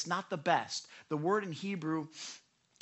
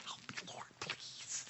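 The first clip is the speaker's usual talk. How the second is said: very soft whispered prayer; desperate whisper; 15 dB quieter than usual. Acted. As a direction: whisper a plea to God. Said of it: "And I'm whispering to the Lord".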